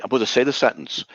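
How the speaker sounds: ordinary talk, nothing marked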